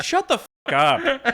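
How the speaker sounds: put-on high, slow, laughing Indian accent; mock Indian accent. Imitating Gandhi telling him off, in a high laughing Indian accent. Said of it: "I couldn't do the Indian accent".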